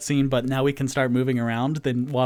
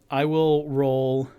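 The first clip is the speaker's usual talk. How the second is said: normal talking voice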